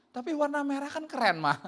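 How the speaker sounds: put-on high-pitched child's protest to mom; arguing kid. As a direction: plead the case like a cheeky child. Child argues with mother. (anak membantah ibu, suara tinggi)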